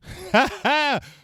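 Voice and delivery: loud, high burst of laughter; high open cackle; real laugh. Real, not acted. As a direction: laugh out loud at a funny comment. Laughs at a viewer's comment.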